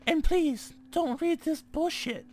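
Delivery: high, loud put-on girl's voice; slow, emphatic high girly reading. Gamer mocking the note he reads, girly voice. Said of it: "Should I be reading this in a girly voice?".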